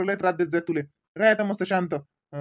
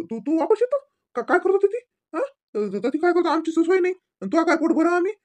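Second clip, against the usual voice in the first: alarmed protest; 8 semitones higher, 4 dB louder than usual. Acted. Father alarmed she'll move back.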